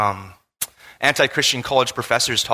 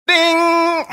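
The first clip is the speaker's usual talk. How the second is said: playful bing; 4 dB louder than usual. Acted. Mock alarm at contradiction.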